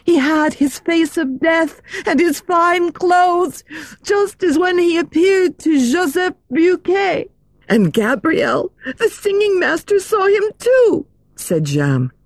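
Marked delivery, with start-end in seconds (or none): 0.0-11.1 put-on high, breathless girlish voice; excited girl voice. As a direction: tell it like a scared schoolgirl. Girls and Jeanne voiced.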